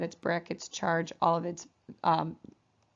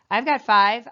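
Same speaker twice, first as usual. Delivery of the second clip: atom calling out louder, a bit higher; mock loud call; 4 semitones higher, 10 dB louder than usual. Teacher voices needy nitrogen atom.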